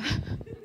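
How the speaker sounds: a quick high soft laugh; high breathy giggle. Real, not acted. Author giggling at her own pacing joke. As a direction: giggle lightly at yourself.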